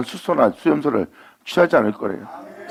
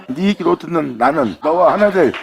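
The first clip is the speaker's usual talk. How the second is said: slow, then climbing higher and louder to the end; building proclamation; 6 semitones higher, 5 dB louder than usual. Acted. Preacher voicing God to believers, rising proclamation. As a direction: swell toward the final words.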